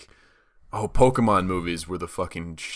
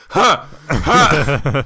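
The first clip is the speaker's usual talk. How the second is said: loud high mock "huh", building; progressively louder; 8 semitones higher, 9 dB louder than usual. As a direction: blurt an exaggerated, insistent anime grunt. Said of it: "slowly progressively say it louder".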